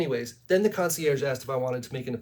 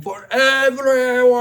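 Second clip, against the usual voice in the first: slow, drawn-out scream, much higher and louder; Gary Oldman scream; 9 semitones higher, 9 dB louder, slower than usual. Retold or imitated. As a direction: bellow it out like a movie villain losing it. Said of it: "when Gary Oldman is screaming".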